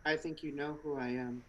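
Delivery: low hushed mysterious woman. Acted. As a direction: speak low and secretive, like a mysterious agent. Plays Trinity, low quiet voice.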